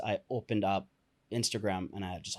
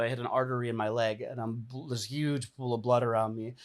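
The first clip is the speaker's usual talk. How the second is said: ordinary talk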